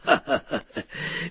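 low, quiet acted chuckle; soft storyteller's laugh in character; father's chuckle. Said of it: "一听笑了".

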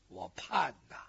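breathy whisper, almost no voice; a whispered sigh of waiting; whispered longing. Acted. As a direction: let the yearning fall to a whisper. Longing lament, repeated phrase.

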